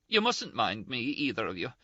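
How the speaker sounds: high-pitched, indignant, close to tears; Ferguson's scolding. A narrator's reading. Ferguson voiced, upset.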